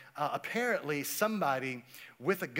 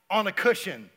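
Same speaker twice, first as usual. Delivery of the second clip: ordinary talk, nothing marked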